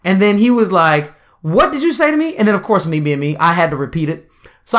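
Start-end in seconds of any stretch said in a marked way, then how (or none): 1.4-2.4 outraged guard. Retold or imitated.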